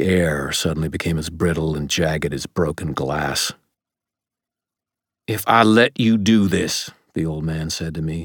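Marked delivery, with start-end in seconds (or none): 5.3-6.9 raised, higher, urgent pleading; desperate. A narrator's reading. Old man pleading with his grandson, desperate. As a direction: plead forcefully, desperate to stop him. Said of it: "his voice desperate".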